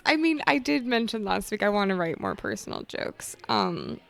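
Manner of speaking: usual speaking voice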